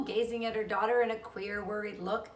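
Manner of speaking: usual speaking voice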